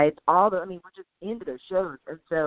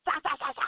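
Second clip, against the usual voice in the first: high, softer repeated strikes; scorpion sting noise; 8 semitones higher, 5 dB quieter, faster than usual. Retold or imitated. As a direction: mimic repeated jabs, high and light. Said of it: "the scorpion's still singing".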